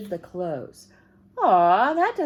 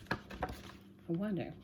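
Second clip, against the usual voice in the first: whispered wonder; 2 semitones lower, 9 dB quieter than usual. Real, not acted. Reading to children, aside.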